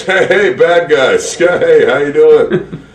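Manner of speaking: loud, slow, stretched "how you doing"; Vince McMahon impression. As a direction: greet him like an overeager wrestling boss. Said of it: "Who does such a good Vince impersonation".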